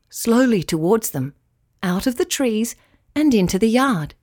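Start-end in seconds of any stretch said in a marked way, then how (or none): none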